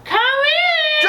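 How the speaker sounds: high, far-off sing-song come in; mock welcoming host. Friend voicing the victim who invites Dracula in, high and cheery. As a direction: play the clueless victim inviting him in.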